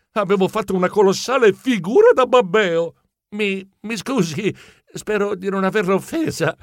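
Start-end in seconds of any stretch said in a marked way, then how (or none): 3.4-6.6 embarrassed stammer. Acted. Geronimo apologises to the champion, embarrassed stammer. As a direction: stumble on the first word, apologising sheepishly. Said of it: "balbettai al campione".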